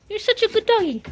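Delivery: high squeaky praise for a dog; sweet high pet praise; squeaky praising a dog. Real, not acted.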